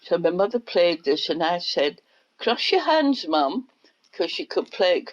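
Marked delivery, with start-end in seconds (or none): 2.4-3.7 retold child urging.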